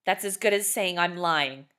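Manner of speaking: loud, indignant shout. A narrator's reading. Father rejecting Missus West's excuse, loud indignant shout. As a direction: throw the words back at her. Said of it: "Mister Worthington shouted".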